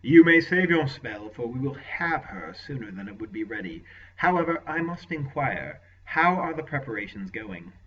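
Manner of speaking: a hint of a cold commanding lord; lightly voiced ruthless lord; lord's voice. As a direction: lord questioning his sorcerer, lightly voiced.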